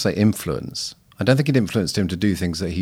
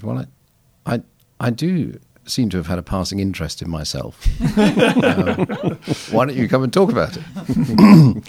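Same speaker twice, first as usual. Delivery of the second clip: put-on laughing, half-hearted try at an old man's wiry accent, rising higher; imitating Leslie Newbigin; 6 semitones higher, 6 dB louder than usual. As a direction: lightly mimic the old theologian's wiry voice through a chuckle. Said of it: "I can't do his accent, but a wiry accent, sort of very sinewy voice".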